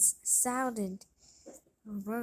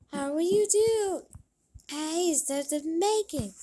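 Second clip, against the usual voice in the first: high, quick put-on voice for a spoiled sister; lightly voiced stepsister squabbling; higher stepsister voice; 5 semitones higher than usual. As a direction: bicker like a bratty stepsister.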